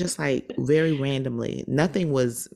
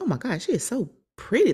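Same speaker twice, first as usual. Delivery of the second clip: awed gush; 8 semitones higher than usual. Retold or imitated. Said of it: "I was like".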